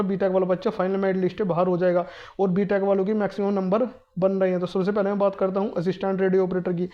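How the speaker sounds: ordinary talk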